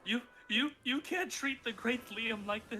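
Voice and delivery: high slow outraged protest; indignant. Acted. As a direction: sputter with wounded pride. Liam indignant captive.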